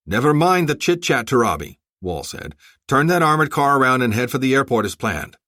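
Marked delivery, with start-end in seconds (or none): 0.1-1.8 loud, gruff brush-off. A narrator's reading. Sergeant to Turabi, waving off chit-chat, gruff. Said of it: "gruff tone of voice". 2.9-5.4 gruff, louder command. A narrator's reading. Sergeant ordering Turabi to the airport, gruff. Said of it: "gruff tone of voice".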